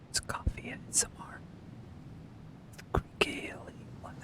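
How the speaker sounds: soft breathy whisper close to the mic; hushed joking ASMR whisper; mock ASMR whisper. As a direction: whisper like a tingles video parody. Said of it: "What do I even do?".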